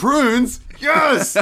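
high thrilled cry at each fruit; excited shout. Pretend. Driver shouting at roadside fruit signs, high thrilled cry. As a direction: yell it like a sign just flashed by. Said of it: "to be like".

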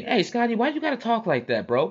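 high-pitched imitation of a protesting friend; objecting homie. Homie challenging Scotty in front of a woman, imitated protest.

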